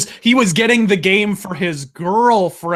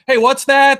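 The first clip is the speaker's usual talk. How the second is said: louder, higher mock puzzlement; mock questioning; 4 semitones higher, 6 dB louder than usual. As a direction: act out someone noticing a glitch. Mocking reviewers watching footage.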